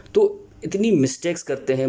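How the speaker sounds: usual speaking voice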